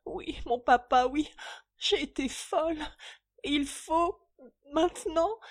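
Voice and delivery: high, tearful daughter pleading through sobs; a daughter's tearful avowal; sobbing confession. Acted. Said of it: "dans une crise de sanglots".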